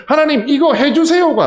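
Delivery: 하나님, 이거 해주세요 said loud and high, falling on 해주세요가; loud cited plea. Retold or imitated. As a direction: proclaim the plea out to the hall. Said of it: "아니라".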